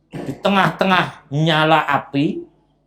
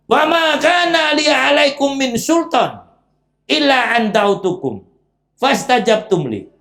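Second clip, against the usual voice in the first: Iblis's speech; 6 semitones higher than usual. Acted. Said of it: "Wah, tambah lagi".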